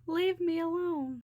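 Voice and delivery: high, loud, slow drawn-out scream; terrified scream. A narrator's reading. Ariel screaming at the monster in her nightmare. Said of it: "screamed Ariel".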